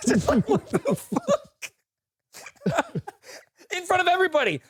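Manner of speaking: high and stunned; incredulous. Real, not acted. Disbelief at coworker's remark.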